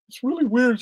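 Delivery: high-pitched retold tearful voice; imitated crying. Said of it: "and then she was crying".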